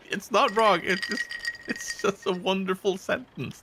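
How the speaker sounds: high, giggling through the words; laughing. Real, not acted. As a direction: laugh while you talk. Laughing at the sentence.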